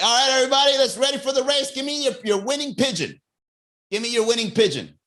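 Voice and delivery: rally the pigeon racers like a host; mock race host. Race host calling the pigeon owners in.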